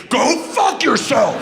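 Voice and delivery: high, drawn-out retold yell; angry retort. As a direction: yell the insult back at the nosy mom. Retold yell at nosy mom.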